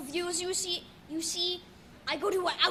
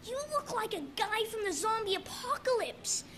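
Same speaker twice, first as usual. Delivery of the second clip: a lightly voiced little kid, higher; 6 semitones higher than usual. A small kid teasing the bandaged narrator, lightly higher. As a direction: pipe it up like a small first grader. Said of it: "he looked at me and he said".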